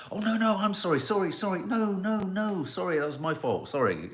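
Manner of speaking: high, overlapping flurry of mock apologies; over-apologetic sorry-off. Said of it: "we get into a sorry off".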